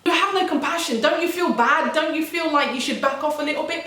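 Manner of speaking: high-pitched, lightly indignant appeal; indignant reproach. Retold or imitated. Thinking at the girl who laughs at tears, indignant reproach. Indignant thoughts aimed at bully.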